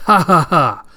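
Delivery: loud villain laugh. Acted. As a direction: gloat with a big staged laugh. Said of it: "And Daifugo goes".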